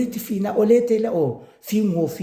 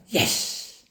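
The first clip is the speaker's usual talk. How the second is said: breathy private yes under her breath; whispered to herself; 3 dB quieter than usual. Retold or imitated. Ami quietly celebrating to herself, whispered. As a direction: whisper it as a secret win. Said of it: "that's when I said to myself".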